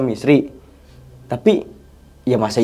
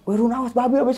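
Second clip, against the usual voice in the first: mock shouted warning in a raised pitch; retold warning yell; 11 semitones higher than usual. Narrator warns tentmate of a boar, mock yelling. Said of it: "Gua teriak-teriak kan".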